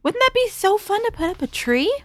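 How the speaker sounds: put-on high, coaxing voice to a child; sweet coaxing voice. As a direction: coax a little girl sweetly.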